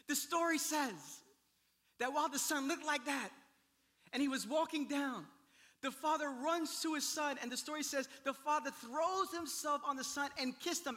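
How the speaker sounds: high, loud, impassioned preacher; forceful preaching. Real, not acted. Pastor retelling the father's welcome, forceful preaching. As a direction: drive the story home with force.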